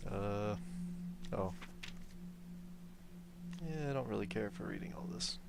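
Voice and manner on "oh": ordinary delivery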